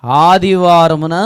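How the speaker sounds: ఆదివారమున stretched slowly and loud; ఆదివారమున read out slowly, loudly; drawn-out reading. Shown as a demonstration. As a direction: read ఆదివారమున slow and booming. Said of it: "first line చదవండి".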